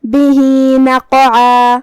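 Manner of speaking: بِهِ with its final vowel stretched over two beats, then a slow, loud recited نَقْعًا; sila demo. Shown as a demonstration. Teacher shows students the short sila on بِهِ. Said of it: "یہ حکم کو ایسے ادا کریں گے".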